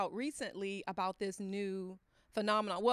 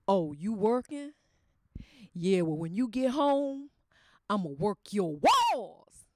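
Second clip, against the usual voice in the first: louder, slow suggestive come-on; husband's bold come-on; 5 dB louder than usual. Retold or imitated. Husband promising his wife a night, slow and suggestive. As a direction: lay the line on thick.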